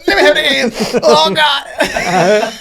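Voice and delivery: very high, loud, laughing; laughing junkie squeal. Retold or imitated. Friend collapsing into laughter as junkie kid, high squeal. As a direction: crack up mid-plea.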